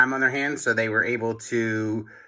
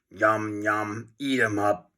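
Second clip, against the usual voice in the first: evil toilet; 4 dB quieter, slower than usual. A narrator's reading. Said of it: "cried the evil toilet".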